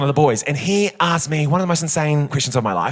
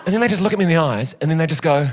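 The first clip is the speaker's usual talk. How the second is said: normal talking voice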